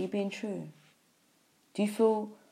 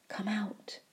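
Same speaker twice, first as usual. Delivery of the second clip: breathy urgent whisper of "Come out"; 3 semitones higher, 4 dB quieter than usual. Real, not acted. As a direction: whisper it like a secret summons. Urgent call to the listeners.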